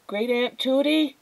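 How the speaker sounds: put-on high, thin child's call; little boy's high-pitched question; small voice calling. A narrator's reading. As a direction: call out uncertainly for a great aunt. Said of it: "A small voice called out".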